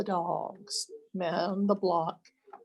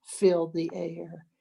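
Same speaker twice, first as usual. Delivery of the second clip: ordinary delivery, nothing marked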